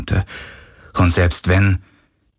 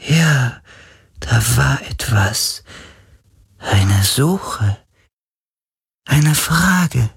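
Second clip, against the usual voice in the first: searching spirit voice; 6 semitones higher, much slower than usual. Acted. Spirit straining to remember.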